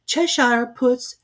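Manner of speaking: put-on high, slow, hesitant girl's voice; timid Alice. A narrator's reading. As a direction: ask the cat shyly. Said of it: "she began rather timidly".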